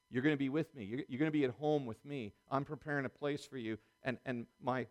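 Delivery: usual speaking voice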